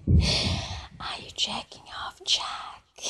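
very soft, fully whispered question; teasing whisper. Acted. Caller privately teasing Jack on the phone, whispering. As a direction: drop right down to a whisper.

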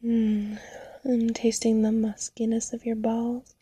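breathy close-mic whisper. Acted.

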